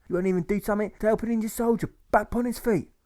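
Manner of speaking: very high, affronted complaint; high-voiced outraged whine; indignant bandit. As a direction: berate him in a high comic voice. Bandit berates Alfred.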